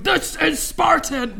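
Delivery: loud, slow mock-heroic movie shout; mock war cry. Panellist imagines him walking round an IKEA, mock-roaring. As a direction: call it out like a film hero, laughing around it. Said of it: "walk around going".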